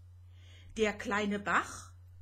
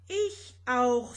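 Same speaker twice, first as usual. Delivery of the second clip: ordinary delivery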